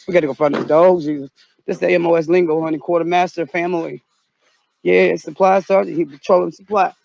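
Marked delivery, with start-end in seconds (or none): none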